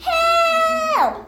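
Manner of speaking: squealing yell for help; high cry for help. Acted. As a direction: shriek it out in panic. Troll thrown up, cries out.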